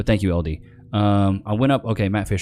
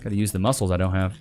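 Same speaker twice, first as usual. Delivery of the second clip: ordinary talk, nothing marked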